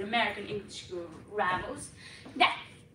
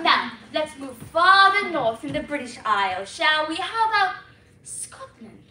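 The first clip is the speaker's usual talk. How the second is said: normal talking voice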